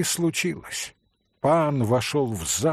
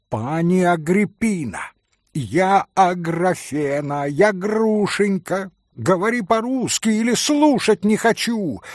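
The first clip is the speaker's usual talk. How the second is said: angry insistence; 6 semitones higher, 4 dB louder than usual. Acted. Грушенька в гневе кричит.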